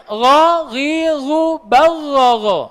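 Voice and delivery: gargle the gh gently; voiced ghain. Shown as a demonstration. Teacher drilling ghain sounds. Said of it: "kalau ghain".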